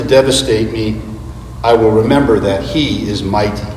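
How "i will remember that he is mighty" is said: ordinary talk, nothing marked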